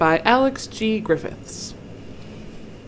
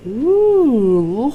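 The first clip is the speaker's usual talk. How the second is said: long, swooping, louder "ooh"; awed ooh; 5 semitones higher, 8 dB louder than usual. Acted. Reader marvels at the insect display, awed ooh. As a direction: coo in wonder.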